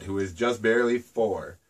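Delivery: ordinary delivery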